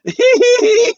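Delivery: very high crying laugh; high broken sob-laugh. Acted. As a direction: break into a high sobbing laugh. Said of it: "he starts to cry".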